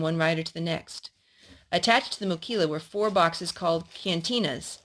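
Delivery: steady storybook reading voice; reading a story to a little boy; story narration. Acted. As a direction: read it like a picture book to a child. Adult reading a story aloud.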